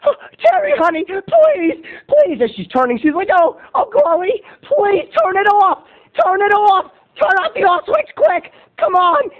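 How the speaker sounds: high, frantic pleading voice; panicked pleading. Retold or imitated. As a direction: beg in a panic. Teacher pleading mid-spin.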